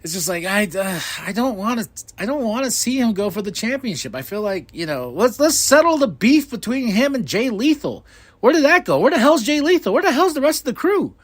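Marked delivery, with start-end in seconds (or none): 5.1-11.1 high, insistent questioning; exasperated rant. Real, not acted. Fan demanding to know where the crew went, exasperated. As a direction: fire off indignant questions, pitch raised throughout. Ranting about a dropped storyline.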